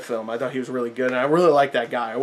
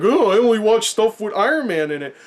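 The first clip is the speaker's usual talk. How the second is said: higher, whiny mock fan voice; mocking snobby moviegoer; 6 semitones higher, 3 dB louder than usual. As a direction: sneer it like a picky fan. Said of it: "you're just like".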